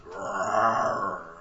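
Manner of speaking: long, low imitated roar; grizzly bear growl. Investigator describes the recorded growl, long low bear roar. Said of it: "It sounded like a grizzly bear".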